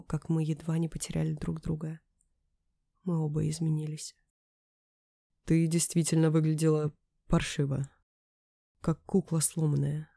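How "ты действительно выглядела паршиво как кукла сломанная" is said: hoarse. Acted. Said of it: "хрипло произнёс".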